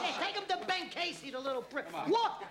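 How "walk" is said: loud, higher bark; barked order. Acted. Gangster ordering the wounded waiter to walk, barked order. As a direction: snap the order at him.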